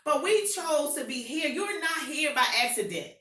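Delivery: rally the crowd like a revival preacher; hyped call to the congregation; fired-up preaching. Acted. Preaching at full force.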